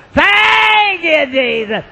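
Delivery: high, loud, drawn-out wail; same crying monotone. Retold or imitated. Lady changing her words, still mock wailing. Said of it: "Same old monotone".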